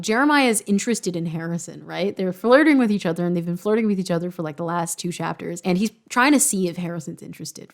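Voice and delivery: ordinary delivery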